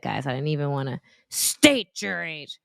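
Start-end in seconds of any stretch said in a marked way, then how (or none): 1.3-2.6 slow, flat command, lightly voiced stranger; gym guy's demand. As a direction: drag out each word like a stern stranger. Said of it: "it sounded just like that".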